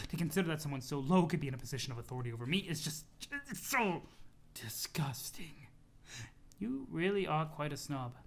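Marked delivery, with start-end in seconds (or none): 3.5-5.7 disgusted whisper. Acted. Snob recoiling in disgust.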